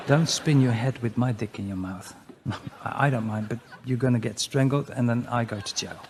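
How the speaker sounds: ordinary delivery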